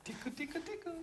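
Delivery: put-on very high, soft baby talk; giggly tickle attack. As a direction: coo softly up high at the baby. Tickling baby to make her laugh.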